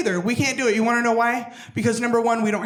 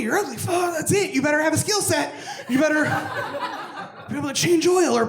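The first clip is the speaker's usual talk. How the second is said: comic rant; 7 semitones higher than usual. Acted. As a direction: rant it out like a fed-up friend. Ranting about skinny ugly people.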